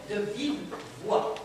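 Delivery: soft, drawn-out upper-class French; posh bourgeois drawl. Acted. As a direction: savour the words like a grand lady. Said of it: "je me mets à parler comme une bourgeoise".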